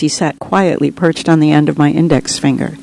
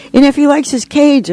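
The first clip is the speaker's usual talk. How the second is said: put-on much higher, eager child's voice; excited little boy; 9 semitones higher than usual. A narrator's reading. Second boy adds his question about the dove, excited. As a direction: chime in like an excited kid, pitched well up. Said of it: "another excited little boy added".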